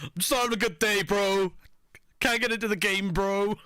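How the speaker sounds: high, fast mock whine of a sulking gamer; whiny gamer parody. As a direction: parody the sulking streamer, bro. Mocks the whining streamer.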